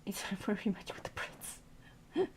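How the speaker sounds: slow, very soft whisper; amused whisper. Real, not acted. Streamer's aside to chat about the Brits, whispered. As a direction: whisper it around a stifled laugh. Aside under the breath, laughing.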